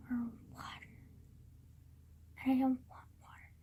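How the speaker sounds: sulky, barely voiced aside; grumbling whisper. Real, not acted. Complaining to camera about drinks, in a sulky whisper. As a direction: whisper the complaint under your breath.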